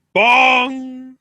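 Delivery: loud, high held bong; vocal gong with a long tail; imitated bell toll. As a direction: toll like a big entrance bell, ringing out. Imitates Undertaker's entrance bell.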